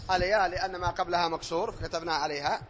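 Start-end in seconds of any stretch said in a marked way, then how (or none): none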